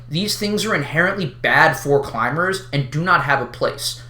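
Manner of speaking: normal talking voice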